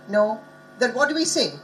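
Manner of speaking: ordinary delivery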